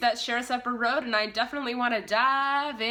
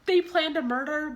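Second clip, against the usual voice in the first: ordinary talk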